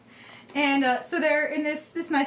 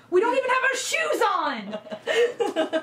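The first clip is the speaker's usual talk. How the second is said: laughing protest; 7 semitones higher, slower than usual. Retold or imitated. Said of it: "And we were like".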